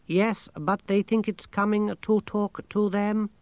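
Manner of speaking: high, slow monotone machine voice; talking machine, matter-of-fact. A narrator's reading. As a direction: explain it evenly like a computer.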